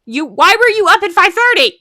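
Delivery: loud, high, startled question; dad's surprise. Retold or imitated. Dad finding the cup at breakfast, startled. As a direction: fire it off in disbelief. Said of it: "And he goes".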